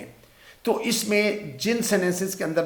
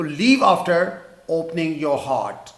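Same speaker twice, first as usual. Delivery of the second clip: slow example; much slower than usual. Shown as a demonstration. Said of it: "اس کے بعد چلے جانا".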